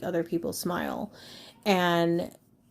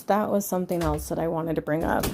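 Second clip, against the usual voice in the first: ordinary talk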